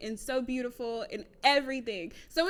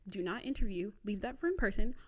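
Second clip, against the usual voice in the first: usual speaking voice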